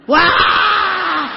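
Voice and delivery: loud, high drawn-out cry; mock exasperated yell. Speaker reacting to a deaf prospect, exasperated yell. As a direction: let out a long yell of disbelief.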